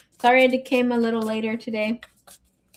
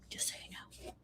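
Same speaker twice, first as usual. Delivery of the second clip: whispered aside; 25 dB quieter than usual. Real, not acted. Teasing aside after raunchy reading.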